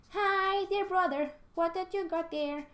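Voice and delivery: put-on very high sing-song girl voice; sweetly nosy little sister; Dee Dee voice. Dee Dee asking her brother what he has, very high. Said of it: "I love Dee Dee".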